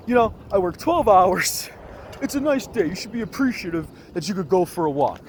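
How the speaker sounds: high, loud imitation of an indignant man; indignant scolding. Angry man lecturing a walker about twelve-hour shifts, mock indignant.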